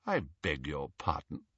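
low, soft, haughty query; affronted disbelief. A narrator's reading. Portrait baffled by Harry's request, coolly affronted. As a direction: drop the voice in disdainful puzzlement.

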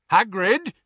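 Ted Tonks warns Hagrid the portkey is going; warning call. A narrator's reading. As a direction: call out to stop him in time. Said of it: "said Ted Tonks warningly".

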